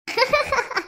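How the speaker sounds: short burst of giggling; amused giggle. Acted. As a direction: giggle before replying. Amused at gossip about Poovarasi. (கலகலவென்ற சிரிப்பு)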